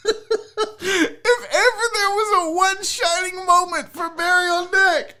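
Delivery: high-pitched voice cracking up with laughter; real overwhelmed, tearful delight; laughing to tears. Real, not acted. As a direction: push the words out through tearful laughter. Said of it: "I'm crying".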